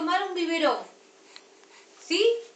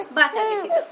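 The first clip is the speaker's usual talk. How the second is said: higher and louder, a sharp no; raised, exasperated stop; firm no; 5 semitones higher, 7 dB louder than usual. Real, not acted. Mother refusing cheese twice.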